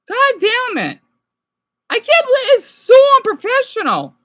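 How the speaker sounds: high, loud exasperated protest; outraged. Real, not acted. Viewer reacting to a hotel owner's threat, outraged. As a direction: protest it with your pitch shooting up.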